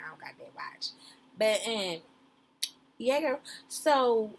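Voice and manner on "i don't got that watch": whispered aside. Real, not acted.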